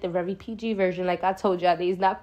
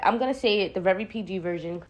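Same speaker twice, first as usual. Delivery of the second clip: ordinary talk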